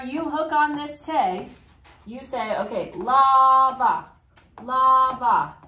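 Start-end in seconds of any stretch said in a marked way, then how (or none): 3.0-5.6 lava with a long, loud LA; stress on first syllable. Shown as a demonstration. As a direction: say lava as LAAA-va, slowly. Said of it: "Your second to last syllable is what gets the accent".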